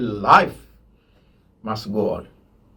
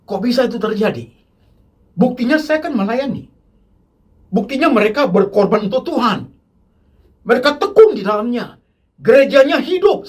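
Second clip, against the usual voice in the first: mock indignant believer defending himself; indignant objection; 6 semitones higher than usual. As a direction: argue back like an offended churchgoer. Objector voiced, rising indignation.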